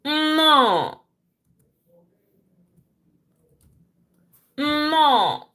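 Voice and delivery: "No" said high and clear, Igbo nnọ; Igbo welcome. Shown as a demonstration. Teacher giving Igbo welcome "No" to students. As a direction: model the word "No" as Igbo nnọ, lifted high. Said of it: "How do we say welcome in Igbo language?".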